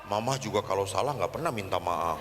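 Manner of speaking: very low, softened retort; reply sulkily under your breath; acted reluctant low answer; grumbling child. Child's grumbling reply about mother.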